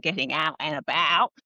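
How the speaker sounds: out and about in an Australian accent; Aussie accent. Shown as a demonstration. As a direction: say it with open Aussie vowels. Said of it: "suit my Aussie accent".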